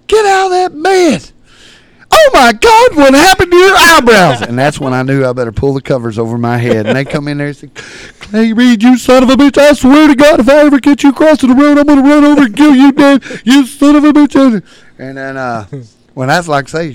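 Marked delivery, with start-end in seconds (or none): 0.1-4.4 high, loud, horrified mother's shriek. Retold or imitated. Mama shrieks at daughter. 8.2-14.6 high, loud, fast enraged threat; furious yelling. Retold or imitated.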